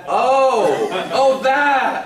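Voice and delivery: high, loud, drawn-out oh; sudden realisation. Retold or imitated. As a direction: exclaim as it dawns on you.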